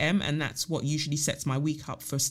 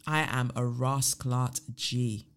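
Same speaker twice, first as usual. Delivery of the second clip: calm affirmation; 4 semitones lower, slower than usual. Shown as a demonstration. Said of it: "say to yourself".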